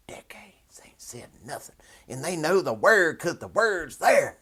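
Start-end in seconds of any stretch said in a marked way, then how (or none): 0.1-1.8 soft whisper, mock secret; whispered aside. Whispering a jab about preachers to viewers. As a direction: lean in and whisper it.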